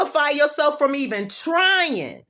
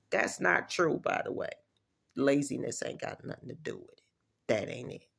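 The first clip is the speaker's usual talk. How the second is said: quiet aside; 12 semitones lower, 12 dB quieter than usual. Real, not acted. Aside, dropped voice.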